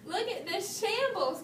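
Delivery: a touch of shock at the mess; mild cry. A narrator's reading. Mama Bear at the bedroom door, mildly dismayed. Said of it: "cried Mama Bear".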